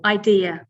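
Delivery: "idea" as eye-DEE-uh; second-syllable stress. Shown as a demonstration. Teacher shows the stress, "idea" with DEE strongest. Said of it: "the stress is on the second syllable".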